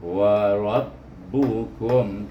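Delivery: wa rabbukum drawn out; very slow wa rabbukum; over enunciating. Shown as a demonstration. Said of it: "kalimah berikutnya".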